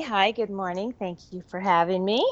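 ordinary talk, nothing marked

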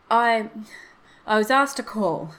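hesitant start, loud then halting; ill at ease. A narrator's reading. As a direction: begin awkwardly, stall after the first word.

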